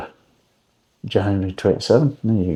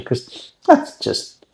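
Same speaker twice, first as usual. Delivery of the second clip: sarcastic; 3 dB quieter, much slower than usual. Acted. Sarcastic aside about waxy lemons.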